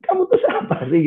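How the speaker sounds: a laughing, high-pitched who-are-you jab; laughing mock retort. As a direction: toss it back half-laughing like an irritated kid. Annoyed kid retort, laughing.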